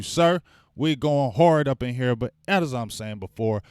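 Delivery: ordinary talk, nothing marked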